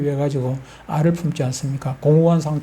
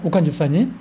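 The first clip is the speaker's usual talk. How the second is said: ordinary delivery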